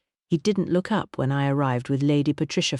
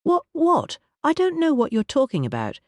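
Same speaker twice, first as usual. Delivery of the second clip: much higher, startled sputter; shocked bluster; 12 semitones higher than usual. Acted. Sir Aubrey caught out, sputtering in higher shock.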